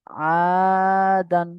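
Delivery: slow, long-held chanted reading of the word; drawn-out recitation. Shown as a demonstration. Teacher chanting a word slowly for students. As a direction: stretch each syllable like Quran recitation. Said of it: "শব্দ জুড়ে দিয়েছে দেখুন".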